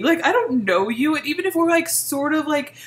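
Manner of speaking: normal talking voice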